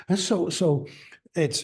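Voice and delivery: ordinary talk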